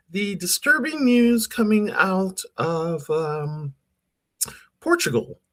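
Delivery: very high effect voice; pitch-shifted voice. Shown as a demonstration. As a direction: talk through a pitch-up effect. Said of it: "work on my voice".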